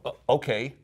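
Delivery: put-on puzzled visitor with an American accent; standard American accent. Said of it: "sorry for the standard American accent".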